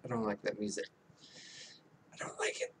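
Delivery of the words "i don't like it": whispered aside, almost secret; a sudden hushed whisper. Pretend. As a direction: breathe it out like a confession. Repeats the complaint in a whisper.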